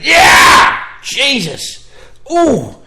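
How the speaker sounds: sharp yelp of pain, pitch jumping up. Retold or imitated. Patient yelping at the nurse's cannula, loud pained cry. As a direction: yell out at the needle going in wrong. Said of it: "and I went".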